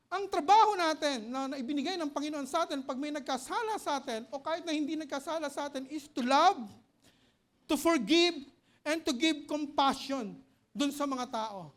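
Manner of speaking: preacher urging forgiveness with force; full-force preaching. Acted. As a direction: press the congregation hard. Preacher rising to loud exhortation.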